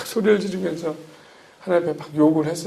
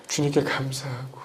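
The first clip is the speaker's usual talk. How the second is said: normal talking voice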